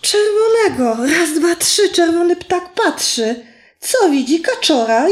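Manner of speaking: put-on high, bright sing-song for a toddler; talking to a baby. Shown as a demonstration. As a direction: coax a little child through the rhymes. Reading to child, praising answer.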